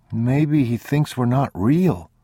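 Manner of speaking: put-on high, slow child's voice; earnest boy. A narrator's reading. Boy to his father about the old man, high child voice.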